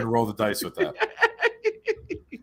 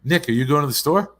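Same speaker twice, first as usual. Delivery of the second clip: higher, louder mom's question with the words run together; quick run-together question; 4 semitones higher, 6 dB louder than usual. Retold or imitated. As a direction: run "Nick, are you" together quickly. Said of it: "say those two words fast".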